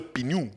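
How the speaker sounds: "pignou" for pneus, two syllables pi-nou. Shown as a demonstration. Co-host explaining regional speech, "pignou" said pi-nou. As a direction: pronounce "pignou" with an added i. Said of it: "il y en a qui vont dire".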